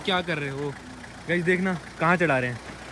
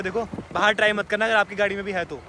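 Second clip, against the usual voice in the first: usual speaking voice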